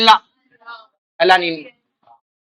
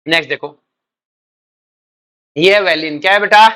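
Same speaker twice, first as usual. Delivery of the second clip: normal talking voice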